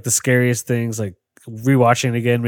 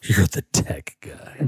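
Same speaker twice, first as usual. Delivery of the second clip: slow breathy whispered repeat; whispered echo; 3 semitones lower, 4 dB quieter, slower than usual. Acted. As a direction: whisper it back slowly. Echoing mockingly in a whisper.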